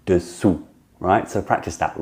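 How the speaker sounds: normal talking voice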